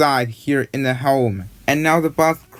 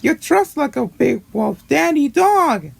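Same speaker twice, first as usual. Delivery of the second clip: very high-pitched cartoon voice; quoted character voice; 12 semitones higher than usual. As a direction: put on a high, exclaiming character voice. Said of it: "he said".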